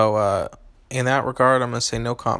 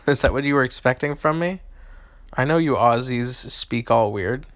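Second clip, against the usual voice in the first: ordinary delivery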